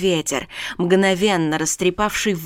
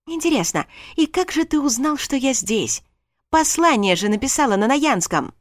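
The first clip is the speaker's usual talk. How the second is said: teasing; 7 semitones higher than usual. Acted. Alexia needles Darren.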